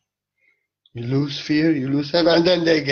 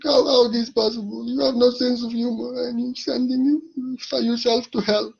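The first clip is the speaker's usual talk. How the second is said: half-laughing fake sob, pitch pushed higher; playful mock tears about humorless people; mock crying; 7 semitones higher than usual. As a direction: pretend to weep over their seriousness, hammed-up. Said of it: "now I'm crying".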